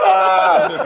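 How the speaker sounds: loud, very high scream; hammed-up shriek of a panicked man; imitated screaming. Tyler screaming as he comes to, loud high shriek. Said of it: "And he's screaming".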